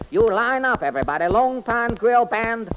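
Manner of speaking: sing-song comic detective character. Acted. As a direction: play the bumbling detective, chirpy. Detective addresses orchestra.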